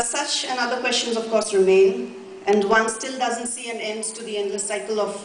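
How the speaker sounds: ordinary talk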